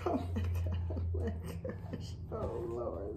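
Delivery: breathless, whispered delight; hushed, awestruck exclamations; overwhelmed whisper. Real, not acted. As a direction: whisper it as if overcome with joy. Overwhelmed by the new bag.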